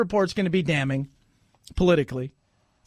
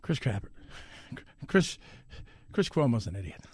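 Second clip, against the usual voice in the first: ordinary talk, nothing marked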